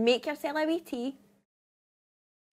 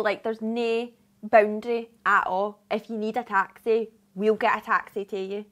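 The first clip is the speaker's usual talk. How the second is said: ordinary talk, nothing marked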